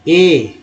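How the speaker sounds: ए as a standalone, falling vowel; the A of care said as ए; vowel sound demo. Shown as a demonstration. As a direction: sound out ए on its own. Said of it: "केयर में ए को क्या बोला गया".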